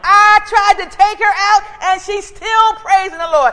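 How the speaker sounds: loud, high, gloating devil; the devil yelling in defeat; the devil boasting. Acted. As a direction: shout it as the frustrated devil bragging. Devil voiced taunting in sermon.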